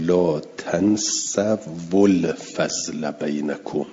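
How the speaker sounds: slow chanted «لا تنسوا الفضل بینکم»; Quranic recitation. Shown as a demonstration. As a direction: recite the verse slowly, drawing the vowels. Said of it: "مثلاً آیهٔ شریفهٔ". (تلاوت آهسته)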